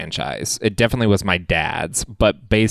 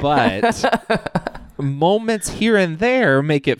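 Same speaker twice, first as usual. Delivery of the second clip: laughing; 9 semitones higher, much slower than usual. Real, not acted. Laughing while making her point.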